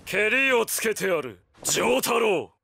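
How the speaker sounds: high, shouting villain, arrogant; taunting villain. Acted. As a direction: bark the taunts down at the hero. Villain taunts in final duel.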